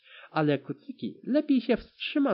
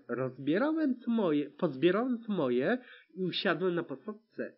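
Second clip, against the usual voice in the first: ordinary delivery, nothing marked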